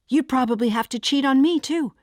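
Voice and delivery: high, loud accusation; bitter outburst. A narrator's reading. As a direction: lash out at your partner. Climax of the acted fight.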